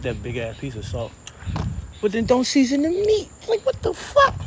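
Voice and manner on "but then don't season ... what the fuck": high, stunned what-the-fuck; incredulous outburst. Real, not acted. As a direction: pitch jumps way up in disbelief. Outraged the meat is unseasoned.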